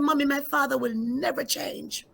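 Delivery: put-on a little girl insisting her father won't change; voicing her young daughter. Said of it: "She said".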